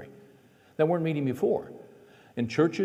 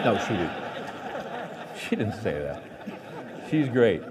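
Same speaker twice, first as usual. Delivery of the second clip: laughing; 3 semitones lower than usual. Real, not acted. Laughs while taking back joke.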